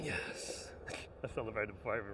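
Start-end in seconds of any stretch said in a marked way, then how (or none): 0.0-0.7 soft whispered yes. Real, not acted. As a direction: whisper it to yourself. Landing trick quietly.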